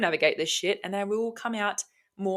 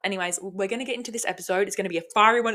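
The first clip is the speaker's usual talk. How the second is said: ordinary talk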